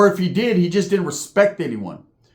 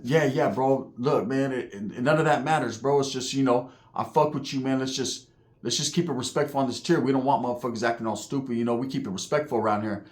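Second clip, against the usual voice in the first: softer, imitating a calm peacemaker; soft and mellow; 3 semitones lower, 6 dB quieter than usual. Uso asks neighbour to keep tier respectful, mellow. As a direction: settle him down gently. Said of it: "like, the softest voice".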